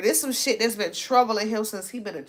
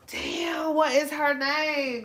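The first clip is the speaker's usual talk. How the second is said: ordinary talk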